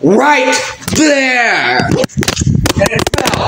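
acted yell at the end of patience; exasperated yell. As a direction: scream it out, holding each word. Yells insisting camera is there.

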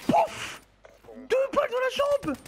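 high indignant yell; raging gamer. Real, not acted. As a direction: rant high and fast. Raging at bad hit registration.

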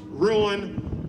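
normal talking voice

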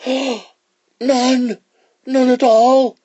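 very high, protesting iguana voice; iguana's cry. Acted. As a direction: cry out a shocked denial, high and loud. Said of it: "cried the iguana".

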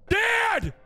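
high shout for Dad; high-pitched yell; calling out. Acted. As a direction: cry out sharply for your father.

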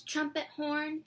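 plain reading voice

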